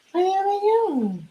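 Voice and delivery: high, fast mock-plaintive call; high sing-song call. Mock-lonely dad, cooking, calls high for his vanished family. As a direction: sing it out high, pretend to be abandoned. Calling after family left.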